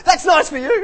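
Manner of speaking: bright mock interruption, shrilled out; loud cheery cut-off. Mimicking the churchgoer who cuts people off, loud and chirpy. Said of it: "And you'd go".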